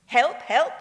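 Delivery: loud, high call of a station porter. Retold or imitated. Porter calling loudly to travellers at Victoria Station. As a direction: call out loudly to grab their attention.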